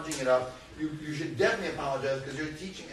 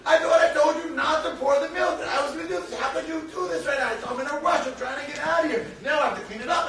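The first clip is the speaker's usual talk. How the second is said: raised voice, much higher and louder, exasperated; upset father scolding; 12 semitones higher, 6 dB louder than usual. Retold or imitated. Father scolding his young son over spilled milk, upset and shouting. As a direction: re-enact an exasperated shouted telling-off. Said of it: "got very upset".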